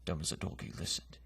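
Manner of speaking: ordinary delivery, nothing marked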